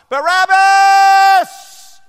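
very high, very loud stretched cry; crowd roar. Acted. Crowd heard from the prison cell, stretched loud roar. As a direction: call the name as a distant mob. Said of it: "He could hear the crowd".

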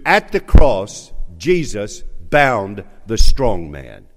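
loud, high, building preacher's climax; preaching at full force. Acted. Pastor rallying his church to the finish, preaching at full force. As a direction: drive it home to the congregation like a revival.

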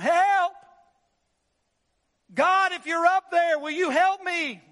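high, loud call for help; man clinging to a root shouting upward; desperate cry for help. Acted. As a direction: yell for help while dangling over a cliff. Man hanging from cliff root.

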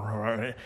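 low mock growl of a guard dog. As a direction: growl like the German Shepherd behind the fence. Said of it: "he's growling".

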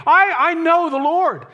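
high, loud stammering "I, I know the Lord"; hammed-up confident professor; mock professing believer. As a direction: mimic a shallow claim of faith. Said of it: "if you say".

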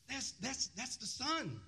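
high, eager stammer of recognition; evil excitement. Acted. Tenants spotting the owner's son coming, evilly excited. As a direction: pitch jumps up in wicked glee. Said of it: "This evil excitement".

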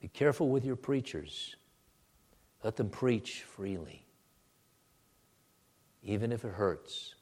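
ordinary talk

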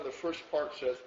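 usual speaking voice

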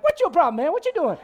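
high, loud comic talking donkey; Eddie Murphy. Acted. Preacher plays Balaam's donkey back-talking, Eddie Murphy impression. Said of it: "Eddie Murphy is in my head when I see a donkey talk".

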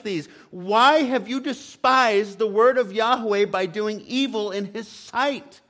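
God's voice rebuking David, rising to a cry; preacher voicing God's indignant charge to David; preaching at full force.

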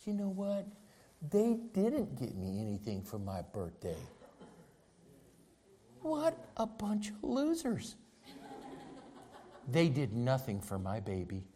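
lightly voiced petty devil, soft and sly; devil's whispering voice. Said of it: "The devil gets you to thinking".

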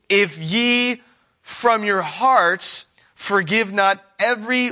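slow, weighty preaching of the verse; solemn scripture reading. Acted. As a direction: lay each word down gravely.

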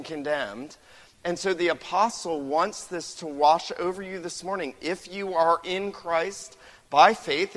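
impassioned pulpit preaching; preaching at full force. Acted. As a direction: press the point home to the church. Preaching builds to climax.

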